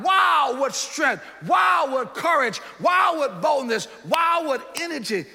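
loud, slow, marvelling preacher cries; preaching exclamations. Acted. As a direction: call out each wow to the congregation. Preacher exclaims at full force.

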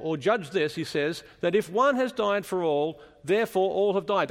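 ordinary talk

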